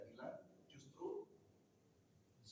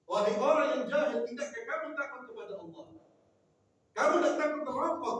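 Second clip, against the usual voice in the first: loud, raised rebuke building to a fast threat; harsh rebuke; 7 semitones higher, 10 dB louder, faster than usual. Shown as a demonstration. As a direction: scold him like a stern preacher. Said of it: "Mulai ada yang meninggikan". (teguran keras, suara meninggi)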